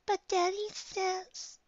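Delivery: put-on high, drawn-out little kid voice; whining child. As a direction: plead slowly in a high child voice. Child argues with mother in story.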